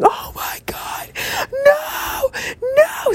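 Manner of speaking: very high, slow crying scream of no; screaming in horror. Retold or imitated. Fans in the theater watch Carlisle die: crying scream. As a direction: shriek it like a horrified fan. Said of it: "screaming at the top of their lungs".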